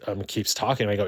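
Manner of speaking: normal talking voice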